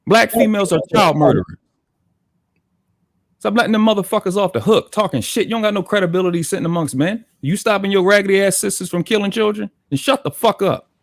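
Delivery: real angry scolding of the other men; irritated. Real, not acted. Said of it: "fucking irritated the shit out of me".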